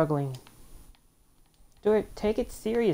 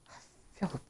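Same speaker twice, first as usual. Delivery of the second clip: whispered aside; 11 dB quieter than usual. Real, not acted. Annoyed gamer.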